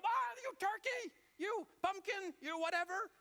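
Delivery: high, loud road-rage yell; shouting at drivers. Acted. Driver yelling at someone who cut in front, furious shout. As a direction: hurl acted insults at the top of your voice. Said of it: "you're shouting at 'em".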